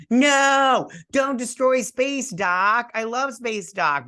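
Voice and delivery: high, pleading cry of protest; high and slow, begging fan; mock protest. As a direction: squeal it out like a fan begging them to stop. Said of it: "I was like".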